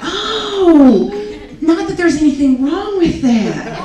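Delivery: high, slow, sweetly insincere Southern lady with a laugh; sugary Southern backpedal. Retold or imitated. Friend backing off a comment, in a sweet mock-Southern voice. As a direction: drip false politeness as she backs off. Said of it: "She said".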